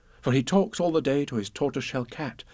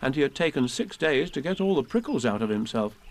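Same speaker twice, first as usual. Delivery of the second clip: ordinary delivery, nothing marked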